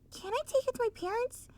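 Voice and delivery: put-on high, fast child asking; little filly voice. Sweetie Belle asking Spike, high and quick. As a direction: ask like a small pony.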